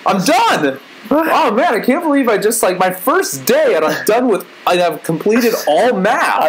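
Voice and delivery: put-on high, awestruck kid boasting; excited four-year-old. Kid on the first day of kindergarten, proudly overexcited. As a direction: gush like a small child who has conquered school. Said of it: "being a four-year-old kid in kindergarten".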